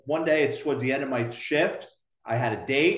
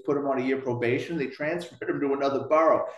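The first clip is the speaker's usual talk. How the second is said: normal talking voice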